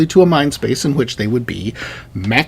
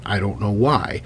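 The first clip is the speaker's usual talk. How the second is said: usual speaking voice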